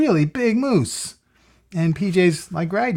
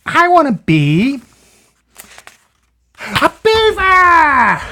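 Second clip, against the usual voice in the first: slow, high, loud shouted beaver; excited announcement; 8 dB louder, much slower than usual. Acted. PJ tells the child what he wants, slow high shout.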